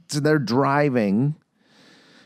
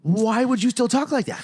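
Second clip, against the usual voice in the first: high-pitched, exasperated question; incredulous; 13 semitones higher, 4 dB louder than usual. Real, not acted. Questioning the actress's lasting accent, incredulous. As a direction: ask it in high disbelief.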